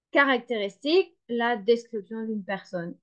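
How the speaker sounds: ordinary delivery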